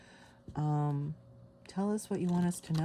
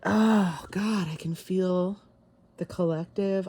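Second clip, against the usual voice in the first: loud, slow burst of awe; a real gasp of wonder, loud and drawn out; overwhelmed awe; 4 semitones higher, 7 dB louder, slower than usual. Real, not acted. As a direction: let the feeling flood out. Overwhelmed by feeling the energy.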